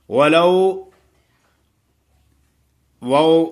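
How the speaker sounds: وَلَوْ with a gliding, softly held و; soft leen vowel. Shown as a demonstration. Teacher models the leen glide in وَلَوْ. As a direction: ease into the و of وَلَوْ. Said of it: "لین ہے".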